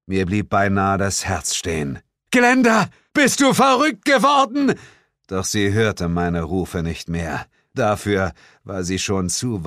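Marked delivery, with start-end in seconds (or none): 2.3-4.8 high, loud cry after her; the hero screams after Glenda running off; horrified shout. Acted. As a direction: scream it in panic.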